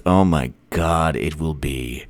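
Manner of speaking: low, soft and slow; hushed relish. Acted. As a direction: sink into a hushed, low murmur. Savouring the imagined election win.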